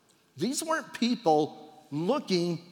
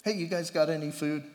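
casual Jesus; faster than usual. Retold or imitated. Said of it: "he said to them".

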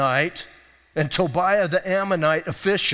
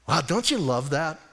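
ordinary talk, nothing marked

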